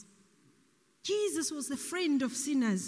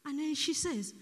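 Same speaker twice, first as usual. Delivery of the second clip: usual speaking voice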